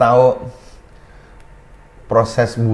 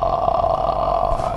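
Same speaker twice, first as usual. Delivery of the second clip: low creaky ah; vocal fry; 3 dB quieter than usual. Shown as a demonstration. Coach demonstrating fry for the chest. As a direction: crackle the sound down low. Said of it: "Vocal fry".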